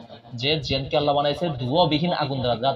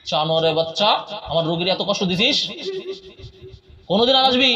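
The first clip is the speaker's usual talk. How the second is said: much higher, loud threatening shout; 11 semitones higher, 4 dB louder than usual. Acted. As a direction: yell at the spirit to leave. Exorcist shouting at jinn.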